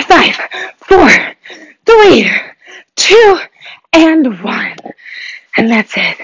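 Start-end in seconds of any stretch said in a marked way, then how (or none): 0.0-4.8 countdown shout. Real, not acted. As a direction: call out each number to the finish. Coach counting down final seconds.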